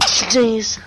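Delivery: a loud real sneeze. Real, not acted. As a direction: sneeze sharply. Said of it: "Sorry for the sneezing there".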